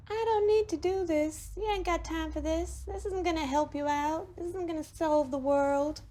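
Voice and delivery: high, whiny inner-voice doubt; nagging inner voice. Acted. Teacher voicing the doubting inner voice. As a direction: play the little voice that talks you out of it. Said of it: "that little voice that goes".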